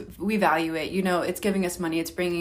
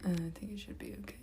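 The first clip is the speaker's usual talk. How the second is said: fast breathless whisper under the talk; whispered aside; 16 dB quieter, much faster than usual. Real, not acted. Murmuring an aside while the host tells her story, fast whisper. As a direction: whisper it quickly to yourself.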